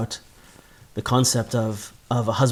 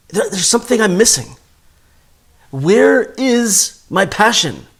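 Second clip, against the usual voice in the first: much higher and louder, aching questions; longing cry; 7 semitones higher, 4 dB louder than usual. Acted. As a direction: cry out for the missing passion. Voicing the soul's longing cry.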